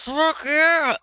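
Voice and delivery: high, loud whoop through food; excited yell with mouth full; muffled cheer. Acted. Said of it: "horribly muffled 'cause he had just taken a big chomp".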